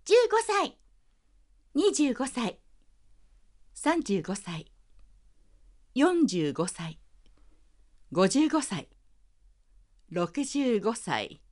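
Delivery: put-on child voice aging to very old, slowing; from little child up to shaky elder; aging voice demo. Shown as a demonstration. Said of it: "はい、やらせていただきなさい。".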